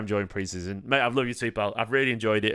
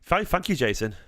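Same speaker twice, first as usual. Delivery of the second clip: normal talking voice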